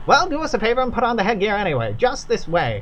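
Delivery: put-on a courteous host with a light Kentucky accent. Doolittle instructing visitors on safety, light Kentucky accent. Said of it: "I'm running out of Kentucky accent variations".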